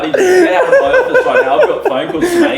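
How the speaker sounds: very high, loud squeal through laughter; hammed-up indignation. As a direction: shriek the demand, cracking up. Repeats demand, hammed up, laughing.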